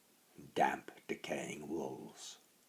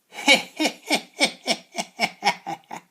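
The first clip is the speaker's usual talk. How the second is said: high, loud cackling snicker; witch's snicker; 13 dB louder than usual. A narrator's reading. Old hag snickering at the boy's hunger, high cackle. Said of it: "Hairy Cheeks snickered".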